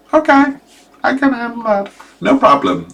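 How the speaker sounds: high, bravely reassuring herself; nervous girl. Retold or imitated. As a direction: voice a girl faking calm. Voicing girl calm at distance.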